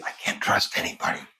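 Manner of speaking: hushed whisper, as a secret; secretive. Shown as a demonstration. Therapist showing the dreamer another tone, whispered like a secret. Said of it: "Say it as a secret".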